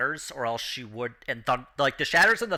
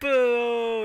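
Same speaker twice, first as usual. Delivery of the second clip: long, high mock boo; drawn-out acted boo; booing crowd; 8 semitones higher, 4 dB louder than usual. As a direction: jeer like a heckling crowd. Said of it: "the crowd just like".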